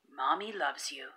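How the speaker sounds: mother's words repeating faintly in his head; soft echo. Acted. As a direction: let it drift away like a memory.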